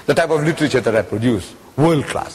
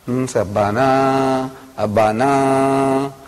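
very slow, drawn-out sounding out; halting reading, syllables held long; puzzling it out; 3 semitones lower, much slower than usual. Retold or imitated. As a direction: stretch the last vowel while deciphering. Puzzling out decorative Arabic script.

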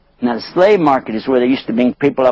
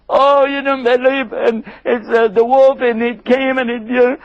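high, flustered made-up excuse; pitched-up nervous stammer; stammering brothers; 11 semitones higher than usual. Acted. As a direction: fumble the wolf story in a high voice.

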